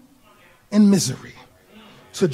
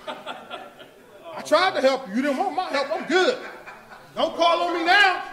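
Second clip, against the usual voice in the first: petty mock voice; 8 semitones higher than usual. Mocking petty people dropping Peter.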